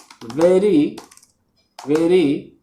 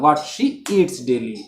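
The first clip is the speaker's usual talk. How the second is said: slow examples; 4 semitones lower, much slower than usual. Shown as a demonstration. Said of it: "नहीं तो पूरा का पूरा क्लॉज होगा".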